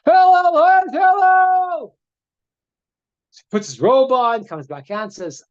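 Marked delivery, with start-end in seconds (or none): 0.1-2.0 very high, slow, loud yell; mock street scream. Joker screaming for Hillel outside the bathhouse, loud high yell. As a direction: howl the name to provoke him. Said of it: "the guy's screaming in the middle of the street".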